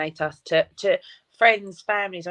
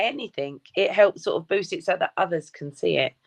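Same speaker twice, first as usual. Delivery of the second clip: ordinary talk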